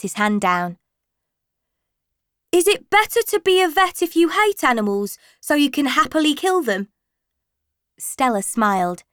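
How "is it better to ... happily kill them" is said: high, fast, cheeky schoolboy; a teenage boy trying to get a laugh. Acted. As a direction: pupil goading the vet with a cheeky question. Cheeky pupil asking a provocative question.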